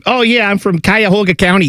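hammed-up Cleveland accent; mock Cleveland accent. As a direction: imitate a Cleveland local proudly overdoing the accent. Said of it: "up in Cleveland where everything's like".

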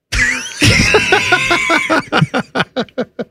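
high burst of real laughter; big laugh. Real, not acted. Cracking up at the co-host's crude joke, laughing.

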